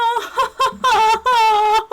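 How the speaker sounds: squealing high laughter; high helpless laugh. Real, not acted. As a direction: laugh hard, pitch way up. Laughing at own game death.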